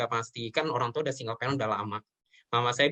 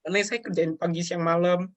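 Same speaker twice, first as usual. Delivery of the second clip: tearful confession; 2 semitones higher than usual. Real, not acted. Telling the host about his darkest time, tearfully. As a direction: push through tears while confessing. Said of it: "Just take your time".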